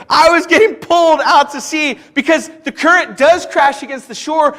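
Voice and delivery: ordinary talk, nothing marked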